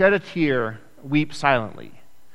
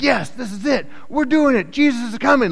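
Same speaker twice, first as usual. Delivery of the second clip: high, loud mock cheering; elated disciple; 10 semitones higher, 4 dB louder than usual. A disciple cheering as Jesus rides in, elated. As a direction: pitch it way up like a thrilled follower.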